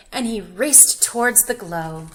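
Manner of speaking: ordinary delivery, nothing marked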